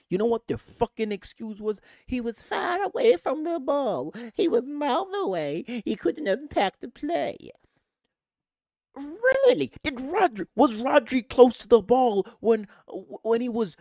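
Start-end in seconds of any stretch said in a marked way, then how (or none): 2.1-7.5 high, loud put-on excuse voice; mocking imitation. Fan mimicking officials' excuse for no penalty, mocking. 9.0-9.7 very high, loud disbelief; incredulous. Real, not acted. Fan answering the officials' excuse, loudly incredulous. 9.8-13.8 loud, high-pitched challenge; indignant. Real, not acted. Fan challenging the officials' excuse about Rodri, indignant.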